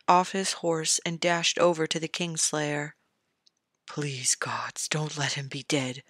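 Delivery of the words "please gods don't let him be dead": lower, hushed, desperate whispered plea; pleading whisper. A narrator's reading. Ned praying over the wounded knight, whispered plea. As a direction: beg the gods under your breath. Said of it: "he pleaded".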